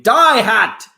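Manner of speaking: screaming. Retold or imitated. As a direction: scream it at the hat. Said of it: "just screaming".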